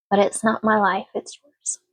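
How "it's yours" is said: whispered. Real, not acted. Quiet emphatic close to advice.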